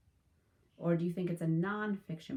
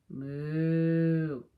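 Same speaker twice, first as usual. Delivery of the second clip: a loud, drawn-out mock moo; playful cow lowing; 8 dB louder than usual. Cows typing refrain.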